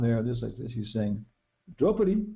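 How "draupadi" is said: high called name; calling out. Retold or imitated. Bhima on the ground calls out to Draupadi, high call.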